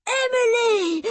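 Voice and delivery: put-on high, loud boyish cry; panted call. A narrator's reading. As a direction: call out urgently as a boy who has been running. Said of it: "he panted".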